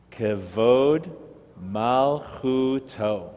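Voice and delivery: Kavod then malchuto, drawn out very slowly; teacher reads slowly so the class can follow; slow model reading. Shown as a demonstration. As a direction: stretch every syllable of Kavod malchuto for the learners. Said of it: "See if you can read the Hebrew".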